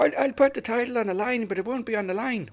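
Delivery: high, loud Irish impression; Irish wrestler contradicting himself; mock Irish accent. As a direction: bluster in an exaggerated Irish brogue. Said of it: "Irish accent like you".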